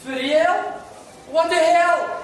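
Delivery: loud, high incredulous outburst; shocked disbelief. Acted. Man reacts to an interruption, loud disbelief. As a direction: blurt it out, pitch leaping up. Startled outburst at overheard words.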